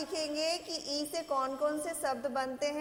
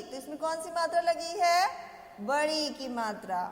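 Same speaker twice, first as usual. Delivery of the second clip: normal talking voice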